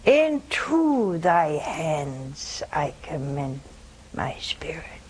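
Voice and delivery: slow, low, solemn prayer; prayerful surrender, fading softly; reverent quotation. Retold or imitated. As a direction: draw it out low, dying away to a hush. Quoting Christ's final prayer, surrendering.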